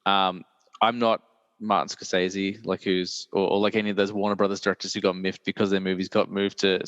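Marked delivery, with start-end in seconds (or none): none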